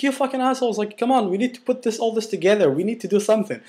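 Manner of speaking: rally a panicking group through frustration; high-pitched mock telling-off; retold exasperated rant. Scolding the crying group.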